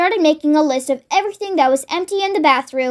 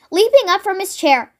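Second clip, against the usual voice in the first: ordinary delivery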